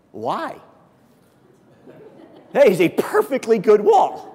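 half-laughing objection; laughing protest. Retold or imitated. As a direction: defend the wall with a laugh. His protest over the wall.

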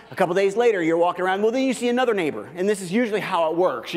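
ordinary talk

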